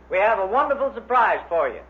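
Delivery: normal talking voice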